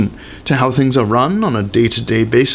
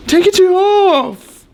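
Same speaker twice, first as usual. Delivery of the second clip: higher, slow, louder demand; demanding diner; 4 dB louder, much slower than usual. Acted. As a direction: drag out the order like a spoiled customer. Belligerent diner makes a demand.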